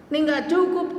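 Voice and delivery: high, anxious fretting; worried hostess. Retold or imitated. Hostess fretting over the food, high and anxious. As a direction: fret that there isn't enough food.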